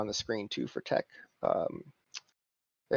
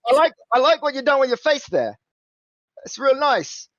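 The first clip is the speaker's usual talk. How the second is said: higher, louder cheeky cockney; cockney fixer; 11 semitones higher, 6 dB louder than usual. Acted. Simon flattering the player's face, cheeky cockney. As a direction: flatter them with a cheeky grin.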